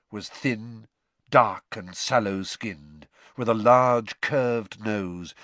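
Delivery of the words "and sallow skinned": plain reading voice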